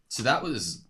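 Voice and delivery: dry sarcastic remark, said low. Real, not acted. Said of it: "That was me being sarcastic".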